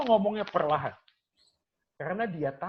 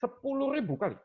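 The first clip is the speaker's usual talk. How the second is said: repeats it high and stunned; shocked; 8 semitones higher than usual. Real, not acted. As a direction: echo the number in disbelief. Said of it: "Come on, man, I'm shocked". (kaget tak percaya)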